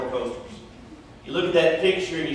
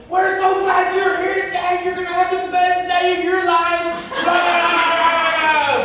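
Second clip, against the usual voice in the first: put-on excited teen employee; 11 semitones higher than usual. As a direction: gush like an overeager sixteen-year-old clerk. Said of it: "he was excited about working".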